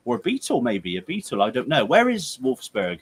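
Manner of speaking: normal talking voice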